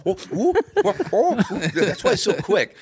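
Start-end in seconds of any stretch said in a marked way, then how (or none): 0.1-1.6 high, jumpy martial arts yelps; coked-up, high-pitched fight noises; Bruce Lee. Acted. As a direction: yelp like a wired kung fu fighter. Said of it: "Bruce Lee's on cocaine".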